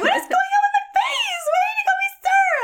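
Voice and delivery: very high, squealing mock outrage; highest pitched voice. Telling Jen about the guard, very high squeal. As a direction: squeal it out in disbelief. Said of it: "my highest pitched voice".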